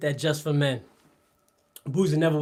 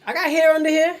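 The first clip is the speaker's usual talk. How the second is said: high, loud protest about his hair; loud insistence; 12 semitones higher, 8 dB louder than usual. Real, not acted. Streamer protesting to chat, loud and high. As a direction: shout it in mock outrage.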